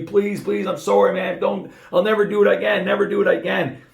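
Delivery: high-pitched, pleading apology; retold begging. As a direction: beg for forgiveness, re-enacting the moment. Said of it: "I'm on my knees begging".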